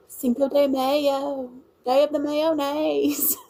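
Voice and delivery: put-on high, stretched-out country Louisiana accent; deep fried cornbread accent. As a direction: mimic the local kids' thick country twang. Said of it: "sometimes I just sound like corn".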